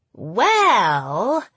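Well with a long trilled tongue-tip roll. A narrator's reading. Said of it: "elongating the word by vibrating the tip of my tongue".

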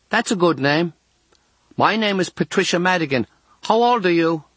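put-on high-pitched girl calling out; girl's voice. A narrator's reading. Girl calling to unseen patient next door, high girlish voice. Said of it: "a girl's voice says".

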